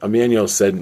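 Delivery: ordinary delivery, nothing marked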